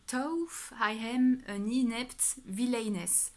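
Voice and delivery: English title read slowly with a French accent. Real, not acted. Booktuber apologising for reading an English title, slow French accent. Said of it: "Vraiment désolée pour mon accent".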